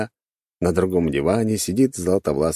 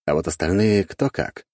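ordinary delivery

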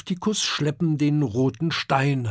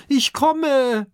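high, loud call; 12 semitones higher, 5 dB louder than usual. Acted. Oskar calling to the departing raft, loud call. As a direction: shout it across the dunes. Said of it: "ruft er".

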